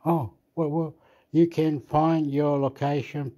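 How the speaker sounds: usual speaking voice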